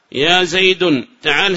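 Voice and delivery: slow "يا زيد", with زيد ending in -un; wrong tanween. Shown as a demonstration. Teacher repeats the unacceptable call, "زيد" said Zaydun. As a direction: draw out "زيد" as Zaydun, n audible. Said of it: "هذا لا يصلح".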